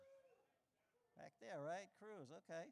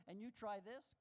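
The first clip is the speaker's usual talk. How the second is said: ordinary delivery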